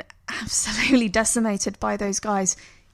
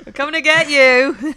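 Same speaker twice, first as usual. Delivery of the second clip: mock threat; 5 semitones higher, 9 dB louder than usual. Quoting gangsters from the film.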